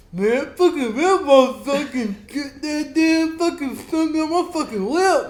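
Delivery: high, loud, slow, laughing through the words; mock the stung guy while cracking up; laughing mimicry. Mimicking stung coworker, loud and laughing.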